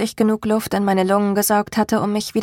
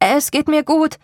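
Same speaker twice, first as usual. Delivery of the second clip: higher-pitched reassuring call; 7 semitones higher than usual. A narrator's reading. Salem calling up from the hole, reassuring call. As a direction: call up to a friend above. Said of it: "rief ich".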